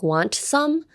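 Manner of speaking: "want some" with the T fully released; over-articulated "want some". Shown as a demonstration. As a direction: say "want some" as two careful, separate words. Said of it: "You wouldn't say, like".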